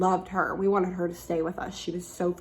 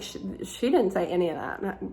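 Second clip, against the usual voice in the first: normal talking voice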